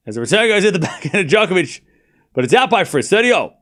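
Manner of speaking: lively live commentary; play-by-play commentary. Acted. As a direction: call the point like a commentator.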